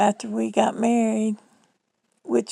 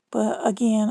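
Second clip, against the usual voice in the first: usual speaking voice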